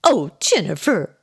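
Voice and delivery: light, bouncy mock scolding; chuckling. A narrator's reading. Aunt catching on to the joke, chuckling. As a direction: say it through a chuckle. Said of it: "she said with a chuckle".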